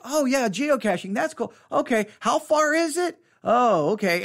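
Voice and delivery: put-on high, half-interested kid voice; bored kid. Distracted kid asking how far, high and half-hearted. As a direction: play the kid who's already losing interest.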